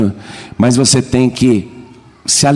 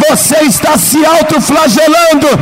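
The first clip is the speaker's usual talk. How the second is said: preaching at full force; 8 semitones higher than usual. Acted. Pastor to congregation, shouting fervent preaching. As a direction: drive it home like the sermon's climax.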